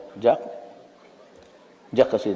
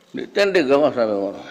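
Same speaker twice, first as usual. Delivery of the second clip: ordinary talk, nothing marked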